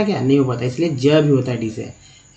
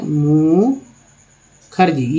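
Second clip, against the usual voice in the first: Mukherjee stretched very slowly; slowly sounding out a name for students; slow demo; much slower than usual. Shown as a demonstration. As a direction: drag out Mukherjee syllable by syllable. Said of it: "इस तरह से".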